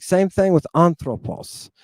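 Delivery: normal talking voice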